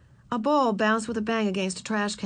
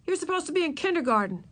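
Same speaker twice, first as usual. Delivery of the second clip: higher-pitched accusing tone; demanding; 9 semitones higher than usual. A narrator's reading. Henry to the hiding kindergartner, demanding. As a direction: point out the offence in a raised pitch. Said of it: "he demanded".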